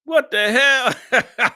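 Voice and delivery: high, slow, drawn-out what the hell; shocked and drawn out; stunned disbelief. Real, not acted. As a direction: recoil in disbelief. Shocked at viewer's look.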